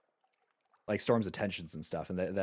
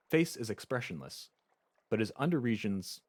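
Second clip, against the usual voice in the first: usual speaking voice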